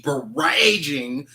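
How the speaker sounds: "barraging" with a long "ray" middle, drawn out; unsure pronunciation. Real, not acted. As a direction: say "barraging" to rhyme with "raging". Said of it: "Is it barraging or barraging?".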